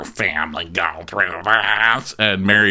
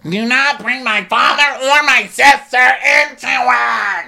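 much higher, louder furious voice; furious shout; 6 dB louder than usual. Retold or imitated. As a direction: shriek it in outrage.

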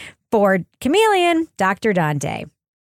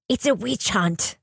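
slow, softer attempt at a New Zealand accent; 3 semitones lower, 5 dB quieter than usual. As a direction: quote a resident in a put-on New Zealand accent. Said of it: "Did you try to say it with the New Zealand accent?".

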